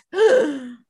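sharp stunned gasp; a gasp as the idea hits; big sudden intake of breath. Retold or imitated. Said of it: "And then suddenly he just goes".

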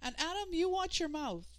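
high, stern motherly call; scolding mother. Acted. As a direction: warn him sharply from down the hall. Mother scolds son down hall.

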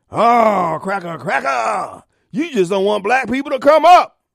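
mock the accuser's whine; a hint of a sarcastic caricature; mock accusation.

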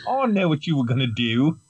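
lightly done Cockney Hal; a hint of Cockney computer; Cockney accent. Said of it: "do his voice in a Cockney accent".

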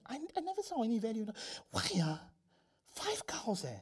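soft, high-pitched baffled wondering; bothered puzzlement. Acted. Father puzzling over five cows, bothered. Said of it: "Always bothered him".

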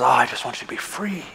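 soft, low, gentle pleading; tender voice of God. Retold or imitated. Pastor voicing God tenderly to the hurting congregation, soft and low. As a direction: murmur it like a loving father. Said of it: "he says".

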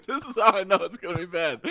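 high, laughing through the words; crying with laughter. Real, not acted. Laughing hard.

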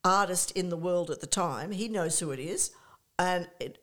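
usual speaking voice

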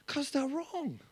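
laughing softly and slowly through it. Real, not acted. Laughing at angry replies.

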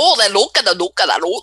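mock Scottish gibberish; cartoonish, swooping Scottish accent; over-the-top Scottish accent. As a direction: babble in a hammed-up Scottish accent. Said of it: "very over-the-top Scottish accents".

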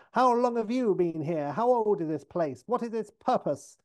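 high-pitched acted interrogation; aggressive shouting. Six losing it at the redhead, acted aggressive shout. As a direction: fire questions at her in a mock outburst. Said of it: "shouts at her aggressively".

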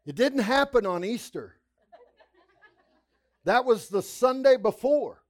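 loud, high preaching testimony, building to a peak; full-force preaching. Acted. Preacher telling the church how he lay dying, loud and high. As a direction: preach it out to the congregation.

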